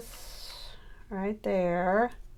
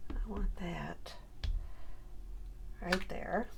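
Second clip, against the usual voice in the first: whisper to herself; 10 dB quieter, slower than usual. Real, not acted. Muttering while placing pieces.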